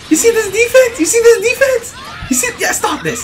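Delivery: very high, repeated crowing; excited squeal. Real, not acted. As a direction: squeak it out over and over. Crowing over own defense in game.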